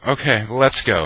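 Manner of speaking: high, loud, public call to leave; announced departure. Retold or imitated. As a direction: announce it for the whole room as the friend. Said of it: "So she goes".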